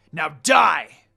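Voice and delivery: loud, explosive shout; battle shout. Acted. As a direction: scream it as you strike. Inuyasha's killing blow.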